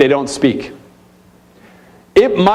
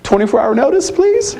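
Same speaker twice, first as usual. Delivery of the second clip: much higher, pleading "please"; host of five kids begging guests for notice; mock pleading; 10 semitones higher than usual. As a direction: beg for warning in a high voice. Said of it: "I'd be like".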